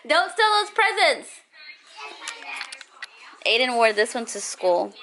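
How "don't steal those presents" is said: laughing high and loud. Real, not acted. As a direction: giggle then tease playfully. Laughs at kid's tough talk.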